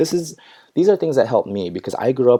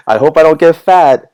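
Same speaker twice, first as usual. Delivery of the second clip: mock worry; 7 semitones higher, 11 dB louder than usual. Repeats worry, laughing, louder.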